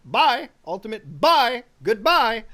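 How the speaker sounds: loud, high, punchy sign-off; hammed-up emphatic goodbye, each one bigger; mock bold farewell. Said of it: "there's some real chat energy on saying".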